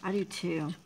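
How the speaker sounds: ordinary delivery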